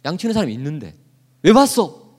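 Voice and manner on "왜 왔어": higher, louder snap at the little brother; mock angry why-are-you-here; older brother scolding David, angry. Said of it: "화를 내는 거예요".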